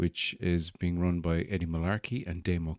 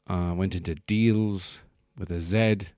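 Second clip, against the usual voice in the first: ordinary talk, nothing marked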